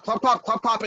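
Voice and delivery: plain reading voice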